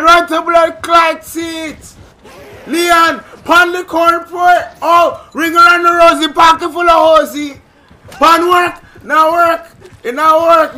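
frantic high-pitched Jamaican yelling; scared Jamaican accent. Real, not acted. Gamer begging teammates for help, frantic scared Jamaican accent. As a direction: shriek for help in loud, panicked patois. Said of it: "when I get scared I turn Jamaican".

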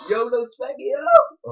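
squeaky over-the-top hype; hyped-up shout. Acted. Friend hyping up a silly remark.

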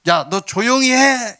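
high, slow mock hushing; scolding. Crowd hushing the noisy blind men, acted drawn-out scolding. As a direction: act out an annoyed crowd telling someone off. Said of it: "막 꾸짖어서".

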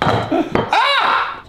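very high sudden scream; startled yelp. Real, not acted. Cook caught off guard at the stove, high startled yelp.

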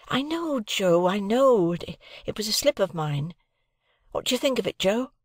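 put-on hurried boy owning up to a slip; young Pip. A narrator's reading. Pip quickly admitting his slip to Joe, a lightly voiced boy.

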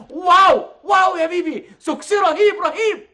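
high, loud, stretched-out wow; gushing excitement. Acted. Director hyping star's entrance by phone, thrilled. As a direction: whoop it up like a showman.

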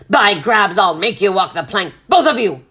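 gruff, blustering threat; irate sea captain; threatening captain. A narrator's reading. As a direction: threaten them with rough force.

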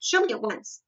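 abrupt command with a touch of impatience; snapped order. A narrator's reading. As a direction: demand it at once. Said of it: "he snapped".

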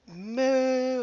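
high mock moo; a silly lowing moo; cow moo. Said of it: "And she'd be like".

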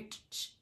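soft breathy ch ch rustle, no voice; a hushed imitation of a rustle; imitated rustling. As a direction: mimic leaves crunching quietly. Imitates the noise again, unvoiced.

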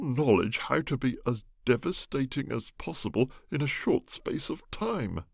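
a hint of an outraged squire; upset gentleman voice. Egbert complains at length.

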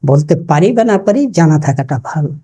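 ordinary talk

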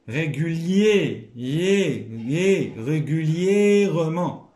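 teacher stressing the accented syllable; slow emphatic sounding-out. Shown as a demonstration. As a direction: stress the yé in régulièrement slowly.

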